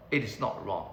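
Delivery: ordinary talk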